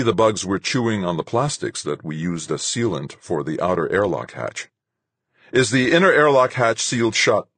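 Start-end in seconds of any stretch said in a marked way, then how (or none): 5.5-7.5 raised, urgent call; pitched-up question called to a crewmate. A narrator's reading. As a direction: call into the next room, higher and louder. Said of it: "I called in to Rodriguez".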